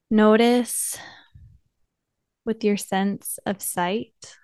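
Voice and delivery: soft, unhurried meditation voice with long pauses; guided meditation. Acted. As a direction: gently guide listeners inward, leaving space between phrases.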